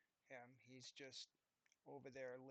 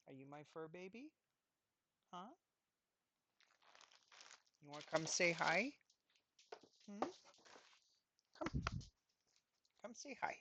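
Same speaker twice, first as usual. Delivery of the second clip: squeaky praising a dog; 3 semitones higher, 5 dB louder than usual. Real, not acted.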